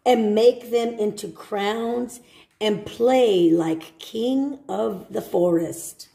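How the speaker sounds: gentle storybook read; reading to children. Acted. Teacher reading a picture book to children.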